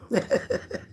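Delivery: breathy high laugh. Real, not acted. Laughing at herself after a joke about weight.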